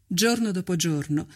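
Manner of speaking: ordinary delivery, nothing marked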